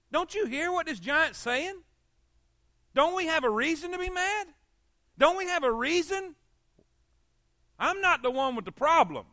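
high, pressing outrage; indignant. Retold or imitated. David challenging the fearful soldiers, indignant and high. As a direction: demand why nobody is angry.

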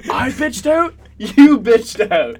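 high, loud mock comeback; indignant dad. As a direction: throw it back at your kid, drawn out loudly. Acted dad quarrels with kid.

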